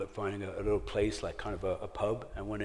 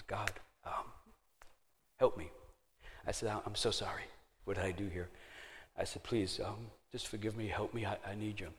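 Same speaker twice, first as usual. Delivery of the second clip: softer, hushed plea to God; quiet prayer; 7 dB quieter than usual. Retold or imitated. Man on the bathroom floor praying to God, quiet and humble.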